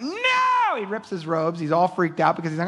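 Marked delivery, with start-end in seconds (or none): 0.0-0.8 sudden high yelp of refusal; a shocked, high "No!"; horrified no. Retold or imitated. Paul horrified at being called god.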